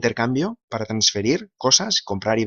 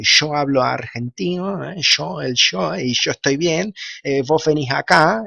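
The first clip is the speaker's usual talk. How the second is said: higher, drawn-out imitation Argentine accent; 4 semitones higher, slower than usual. Shown as a demonstration. Spaniard showing that Argentines keep their accent, imitated Argentine accent. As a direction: put on a lilting Argentine Spanish, pitched up. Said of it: "los argentinos también tienen su acento".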